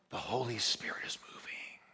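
imitating a whisper. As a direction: hush it in awe. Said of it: "she would say".

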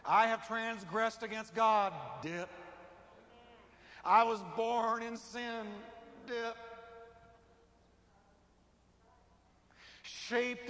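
fervent confession. Acted. Confession with repeated dip.

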